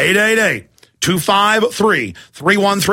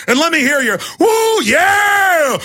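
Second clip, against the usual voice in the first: hype shout; 11 semitones higher, much slower than usual. Real, not acted. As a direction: whoop like at a rally. Host demands crew war growl.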